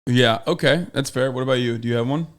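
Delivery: usual speaking voice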